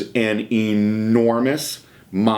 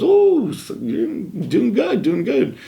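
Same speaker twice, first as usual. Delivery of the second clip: high, drawn-out impressed exclamation; impressed reaction; 14 semitones higher, much slower than usual. Retold or imitated. Bodybuilder sizing up the younger man, impressed. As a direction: imitate an impressed big guy, pitched way up.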